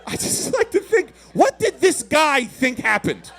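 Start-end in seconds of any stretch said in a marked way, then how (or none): none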